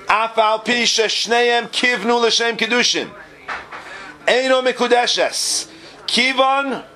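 high, slow declaimed recital; reading Hebrew text aloud. Acted. Teacher reading the Bir Halacha to students, high slow recital. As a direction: read out the source slowly, pitched well up.

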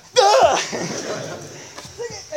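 sudden high yelp; acted pained yelp. Pillow-sitter lands on hidden spikes, acted pained yelp.

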